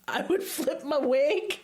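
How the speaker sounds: slow, high, giggly exclamation; drawn out through a laugh. Real, not acted. Laughing at absurd story.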